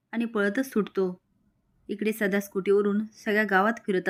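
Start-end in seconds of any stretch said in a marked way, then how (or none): none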